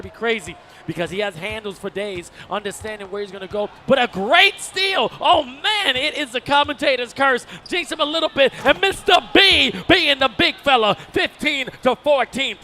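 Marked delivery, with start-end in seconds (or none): 3.9-12.6 loud, high play-by-play shouting; excited steal call. Real, not acted. Commentator calls a steal and score, excited shout. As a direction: erupt at the steal and ride it to the score.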